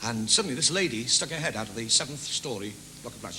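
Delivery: ordinary talk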